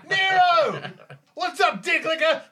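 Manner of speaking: high, loud shout through laughter; loud teasing hello, laughing. Acted. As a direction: yell the greeting while cracking up. Player yelling greeting, laughing.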